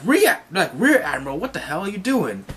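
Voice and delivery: high, incredulous protest; can't believe their boss; exasperated Marines. Retold or imitated. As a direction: Marines scolding their lovestruck Rear Admiral. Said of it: "they're like".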